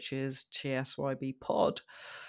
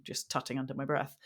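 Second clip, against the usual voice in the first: usual speaking voice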